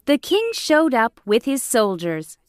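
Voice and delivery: ordinary delivery, nothing marked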